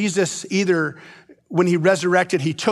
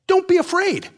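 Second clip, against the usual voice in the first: mock angel; 10 semitones higher than usual. Mocking the angel's greeting.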